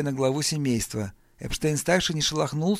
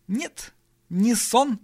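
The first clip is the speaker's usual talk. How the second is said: drawn-out, raised voice while thinking; stalling for words; 9 semitones higher, 5 dB louder, much slower than usual. A narrator's reading. Brian stalls before sceptical relatives, slow and groping. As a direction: stretch it out, buying time. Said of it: "лихорадочно соображая, что же говорить дальше".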